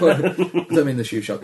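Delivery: laughing through the words. Real, not acted. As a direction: say it while still laughing. Laughing repeat of joke.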